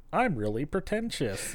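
a hint of self-important cadet; mock pretentious cadet. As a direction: lightly imitate a stuck-up military-school kid. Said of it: "that military school thing".

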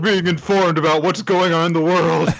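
high, put-on complaining voice; hammed-up wail over the news; mock outburst. Mocking character crying at the news.

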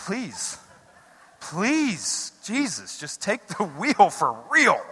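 drawn-out, hammed-up begging; desperate comic plea. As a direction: beg for help, stretching the words. Acted plea to Jesus over road rage.

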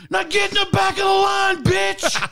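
high, loud mock shout; kid's shout. Man retelling the kid shouting at the lady, high shout. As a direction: order her back like a furious boy. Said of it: "he says".

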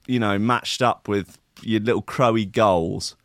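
usual speaking voice

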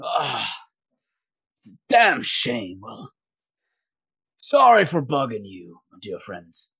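lightly voiced sighing older merchant; a resigned groan, then an apologetic sign-off; older gentleman. Game master voices older merchant.

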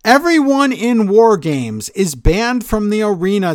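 high, slow mock announcement; hammed-up authority, stretched out; imitated wrestler-voice yell. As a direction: proclaim it like a yelling official. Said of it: "He sure does like to yell lately".

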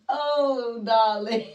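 hammed-up London "Oh, darling" between laughs; fake London accent. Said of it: "telling people she was from London".

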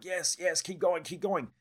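mock eager cheer at the screen; excited cheering. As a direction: cheer it on like a race. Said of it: "And I was excited".

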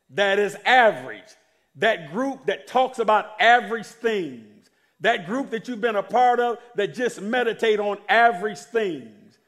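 preaching. Acted. Preacher builds to full force.

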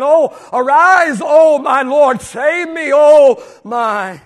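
slow, loud pleading cry of scripture; fervent sermon plea; preached cry. Acted. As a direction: call out to God. Crying out Psalm verse.

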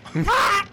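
high loud bird screech; short squawk; parrot screech. Acted.

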